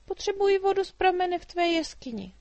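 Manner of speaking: put-on a chatty girl teasing the dragon; little girl Mája. A narrator's reading. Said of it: "vysvětlovala Mája". (vysoký živý dívčí hlas)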